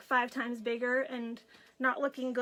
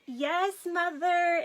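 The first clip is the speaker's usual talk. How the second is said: higher, drawn-out "yes, Mother"; dutiful daughter reply; 5 semitones higher, much slower than usual. Retold or imitated. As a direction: answer Mom slowly, in a raised pitch. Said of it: "And I'm like".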